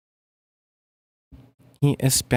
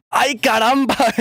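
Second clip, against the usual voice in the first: put-on high, loud, slow gringo-accent Ay caramba; gringo accent shout; 10 semitones higher, 5 dB louder than usual. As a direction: belt it out all caps in a gringo accent. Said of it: "I say that with a gringo accent".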